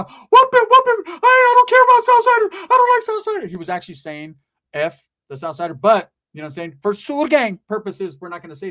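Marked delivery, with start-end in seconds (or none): 0.0-3.5 high, loud, strained put-on yell; mock yelling homie. Homie yelling at the guy getting stomped, high put-on yell. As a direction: scream it like a hyped-up gangster.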